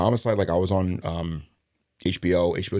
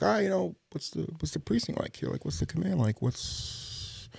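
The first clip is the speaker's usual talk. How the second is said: high, soft voice of the new guy; tentative newcomer asking; 9 semitones higher, 6 dB quieter than usual. Retold or imitated. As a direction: imitate asking colleagues timidly, pitched way up. Newcomer asking colleagues about the precinct.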